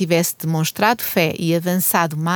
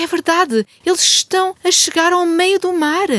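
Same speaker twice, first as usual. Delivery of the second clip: excited alarm; 10 semitones higher than usual. Acted. Pursuers arrive.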